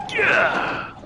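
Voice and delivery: high loud triumphant yell; Larry the Lobster voice. Retold or imitated. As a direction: belt it like a cartoon lobster. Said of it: "the Larry the Lobster voice in my head".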